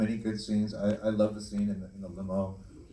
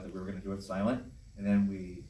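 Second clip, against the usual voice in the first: ordinary delivery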